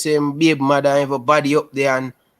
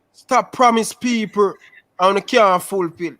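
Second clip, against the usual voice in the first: exasperated scolding; 6 semitones higher than usual. Real, not acted. Rant about broken promises.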